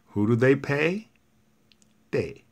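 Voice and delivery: usual speaking voice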